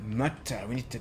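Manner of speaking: "murder" in a put-on Scottish accent; Scottish accent with a hard middle in "murder". Slips back into Scottish accent.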